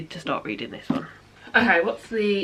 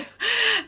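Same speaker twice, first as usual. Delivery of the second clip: high giggle; 13 semitones higher than usual. Real, not acted. Laughs at her own remark.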